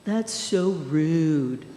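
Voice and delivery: drawn-out, mock-indignant quote; offended young lady. Teacher retelling a student's reaction to a meme, mock offended. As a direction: stretch it out slowly, imitating an affronted student. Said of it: "this young lady walks in and goes".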